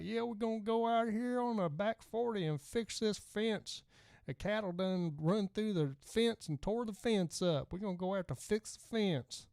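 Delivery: Texas twang. Retold or imitated. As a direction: imitate a Texas rancher with a heavy twang. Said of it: "people that talk just like that".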